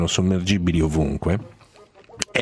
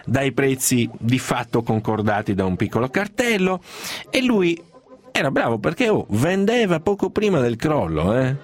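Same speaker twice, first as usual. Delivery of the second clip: excited; 9 semitones higher than usual. Real, not acted. Excited raised-pitch telling.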